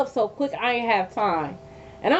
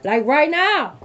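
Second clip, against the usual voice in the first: louder, snapping the final now; louder sharp order; 4 semitones higher, 5 dB louder, slower than usual. Retold or imitated. Ordering the ex out of her face, a louder sharp demand. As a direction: raise it, no more patience.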